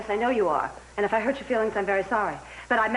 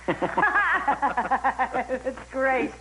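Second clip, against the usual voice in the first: laughing out the words, gloating; a woman laughs at her rival's arrest, amused and gloating. Acted. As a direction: gloat through a laugh at the news.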